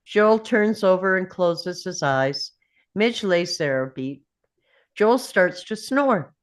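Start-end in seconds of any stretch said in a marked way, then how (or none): none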